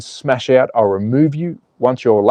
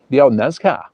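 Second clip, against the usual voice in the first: normal talking voice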